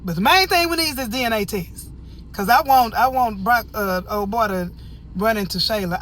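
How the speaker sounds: loud, high, fired-up demand; practically shouting with excitement; excited outburst. Real, not acted. Excited rant about DNA test.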